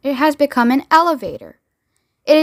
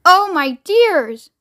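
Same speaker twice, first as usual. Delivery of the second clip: higher, long drawn-out lament; dismayed cry; 6 semitones higher, 4 dB louder, much slower than usual. A narrator's reading. Grandma Georgina fearing the crash, dismayed cry. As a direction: wail it slowly, a touch of dread. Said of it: "cried Grandma Georgina".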